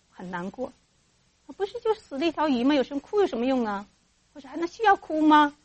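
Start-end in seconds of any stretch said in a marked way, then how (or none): 1.6-5.6 dismissive parent. Acted. Dismissive parent scolds crying child.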